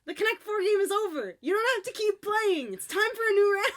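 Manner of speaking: high, loud exasperated lecture; mock scolding kids. Talking to the kids in the ad, loud and exasperated. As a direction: tell the kids off, half joking. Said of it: "And I was like".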